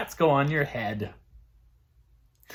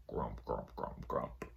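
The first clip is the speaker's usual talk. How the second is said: low, soft grumbling grump; grumpy grumble; 16 dB quieter, slower than usual. A narrator's reading. Grumpy elephant grumbling after waking up. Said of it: "he was very grumpy".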